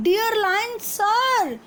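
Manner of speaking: a small rabbit pleading at the cave mouth; loud voice. Acted. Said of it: "he said in a loud voice".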